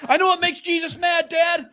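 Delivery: loud, very high mock shout; yelling kid. Son calling to dad in church, high yell. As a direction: shout it proudly to the whole room.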